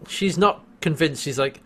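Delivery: ordinary delivery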